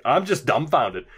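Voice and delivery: ordinary talk, nothing marked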